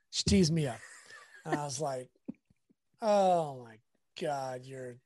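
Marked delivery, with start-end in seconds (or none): 3.0-5.0 drawn-out mock exasperation, swelling on "so wrong"; slow, incredulous verdict in a retold argument.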